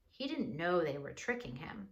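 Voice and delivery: plain reading voice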